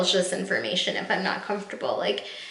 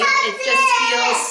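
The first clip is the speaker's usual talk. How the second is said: high, slow, voice cracking; barely holding it together; tearful; much slower than usual. Real, not acted. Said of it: "so emotional".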